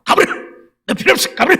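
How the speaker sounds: high, strained temper-fit yell; imitated angry screaming. Friend driving the helper away, mock screaming. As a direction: act out a friend's screeching dismissal. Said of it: "악을 쓰고 성질을 낸다".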